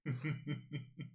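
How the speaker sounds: quiet, pleased chuckle in character; soft villainous chuckle. Acted. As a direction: chuckle softly to yourself. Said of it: "he just smiles and is like".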